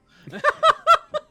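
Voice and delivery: loud high shriek of laughter. Real, not acted. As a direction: burst into a squealing laugh.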